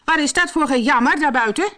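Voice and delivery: high, loud indignant woman; woman's voice. A narrator's reading. Woman indoors hears wailing outside, indignant high voice. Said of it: "hoorden ze een vrouwenstem zeggen".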